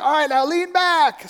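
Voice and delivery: high, slow imitated call; called instruction. Stranger coaching climber on the rope, called out. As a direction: draw it out, calling from above.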